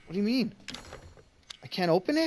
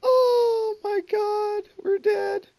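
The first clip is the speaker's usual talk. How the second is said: very high, slow, loud wail; anguished scream; 10 dB louder, much slower than usual. Real, not acted. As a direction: wail it out, defeated. Dying in the game.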